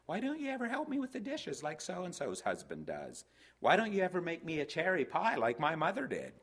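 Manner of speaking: high-pitched mock nagging; nagging complaint. Spouse complaining about dishes and pie, mock nagging. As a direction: imitate a spouse whining out a comparison.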